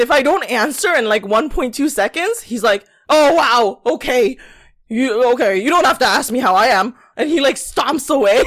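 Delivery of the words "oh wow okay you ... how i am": louder, offended huff; mock huffy coworker. Mocking the coworker who takes offense, loud and huffy. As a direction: play the wounded snob.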